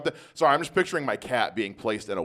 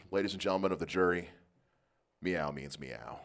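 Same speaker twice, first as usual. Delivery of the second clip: mock solemn lawyer; 7 semitones lower, 10 dB quieter than usual. Lawyer addresses jury.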